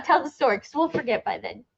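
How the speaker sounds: ordinary talk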